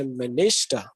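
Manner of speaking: "minister" with the stress on "nis"; middle-syllable stress. Shown as a demonstration. Teacher showing English word stress to students, "minister" stressed on the middle syllable. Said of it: "there is a stress in the middle syllable".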